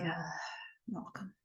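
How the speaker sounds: soft, barely voiced call; hushed call. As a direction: call out under your breath. Hiding at the gate, calling softly.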